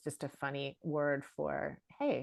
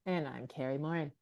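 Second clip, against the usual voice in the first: usual speaking voice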